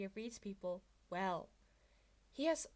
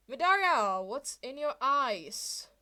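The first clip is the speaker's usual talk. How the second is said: loud, slow alarmed question; 4 semitones higher, 11 dB louder, slower than usual. Acted. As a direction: call out in alarm across the room. Classmate alarmed.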